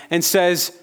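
ordinary talk

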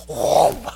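loud imitated whoosh of an embrace; a sudden hug whoosh; mock impact sound; whoom. Father's embrace sound effect.

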